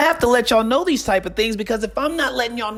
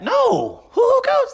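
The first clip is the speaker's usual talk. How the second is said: No, Hoo Hoo Coach jumps much higher and louder in disbelief; incredulous; 3 dB louder, slower than usual. Retold or imitated. Refusing to believe the rumour, high incredulous squeal. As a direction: squeal it out like you can't believe it.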